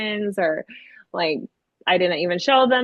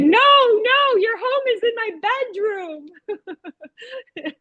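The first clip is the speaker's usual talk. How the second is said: put-on insistent child; 11 semitones higher than usual. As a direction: correct it urgently in a little kid's voice. Retelling child's insistent correction.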